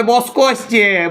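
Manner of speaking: বস্কো said slowly with swapped sounds; local mispronunciation. Shown as a demonstration. Teacher imitating villagers saying বস্কো. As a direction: draw out বস্কো for বক্স. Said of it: "লোক বলে".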